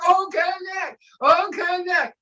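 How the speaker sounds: high sing-out impression, each time falling at the end; imitating dancing enthusiast. Imitating Doctor Derek dancing about O Connect, high and gleeful. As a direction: mimic his gleeful product chant. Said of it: "he's always dancing".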